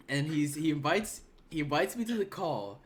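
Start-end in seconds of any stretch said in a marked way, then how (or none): none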